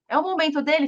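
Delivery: usual speaking voice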